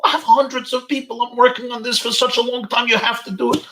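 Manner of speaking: high, loud pleading protest; upset rabbi. Retold or imitated. Rabbi on phone, upset his lecture is ruined, high loud protest. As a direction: insist you can't let him down. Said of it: "Oh, he was so upset".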